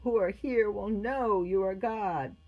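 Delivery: solemn, lifted invocation; praying aloud. A narrator's reading. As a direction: call up to God earnestly. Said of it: "began to pray".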